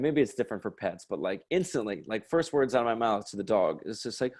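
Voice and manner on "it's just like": ordinary delivery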